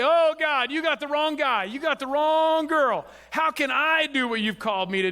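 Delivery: hammed-up reluctant servant pleading; playful mock complaint to God; mock protest.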